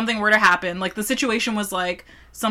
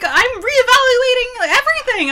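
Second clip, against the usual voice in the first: high, loud imitation of a distraught girlfriend; mock melodrama; 14 semitones higher, 7 dB louder than usual. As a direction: ham up the overwrought complaint. Mimicking the overdramatic girlfriend.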